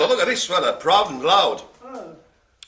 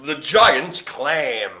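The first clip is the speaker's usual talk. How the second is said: slow drawn-out announcement; 3 semitones lower, much slower than usual. Shown as a demonstration. Host unveiling the next sea creature, slow drawn-out announcement. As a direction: stretch the giant clam out very slowly. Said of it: "After that, we've got".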